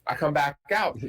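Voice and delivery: usual speaking voice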